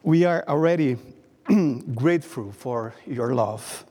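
ordinary talk, nothing marked

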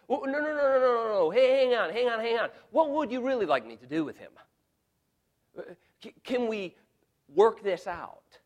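Pilate begging the crowd, high and loud; a flustered governor trying to calm a mob; pleading Pilate. Acted. Pilate pleading with the crowd, loud and high.

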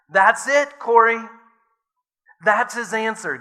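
Betsy can hardly contain her joy; elated. A narrator's reading. Betsy telling Corrie to thank God for everything, elated. Betsy's joyful discovery in barracks.

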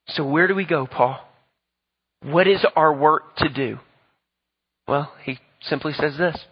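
loud, driving pulpit preaching; preaching at full force. Real, not acted. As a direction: hammer it home to the congregation. Preaching climax.

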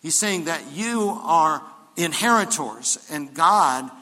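loud, rising preacher's build; full-force preaching. Acted. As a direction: drive it home to the congregation.

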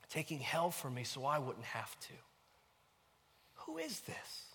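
high, awed questioning, swelling then hushed; wonder and amazement. Acted. Preacher asking who this Jesus is, in wonder. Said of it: "out of wonder and amazement".

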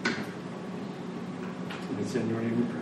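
usual speaking voice

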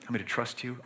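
quiet prayer. Retold or imitated. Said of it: "You just say".

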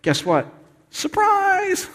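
very high, sing-out "surprise!"; playful surprise reveal. Pretend. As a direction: pretend to spring a joyful surprise. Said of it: "Jesus would go".

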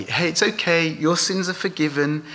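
offhand assurance. Shown as a demonstration. Preacher showing how easily anyone could say it, offhand. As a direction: toss off "your sins are forgiven" lightly. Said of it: "anyone could say".

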